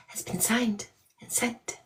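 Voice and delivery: soft secret whisper. Real, not acted. Confiding big news.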